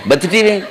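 mocking echo of బత్తి with plain b; imitated anchor's unaspirated బత్తి; missing aspiration. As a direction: say బత్తి for bhakti, no breath on the b. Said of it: "ఒత్తులు పలకలేదు".

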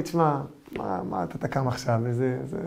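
soft coaxing evil inclination. Acted. Evil inclination voiced whispering.

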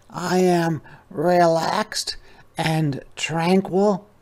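high, slow, drawn-out sloth voice; unhurried sloth. Acted. Sloth voiced in read story.

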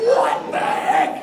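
high, slow, loud shout; outraged wife. Retold or imitated. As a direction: act out an outraged yell. Wife's outraged reply, acted.

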